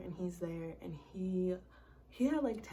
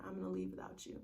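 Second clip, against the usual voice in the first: mock low threat; 12 semitones lower, 4 dB quieter, faster than usual. Quoting the ex's threat.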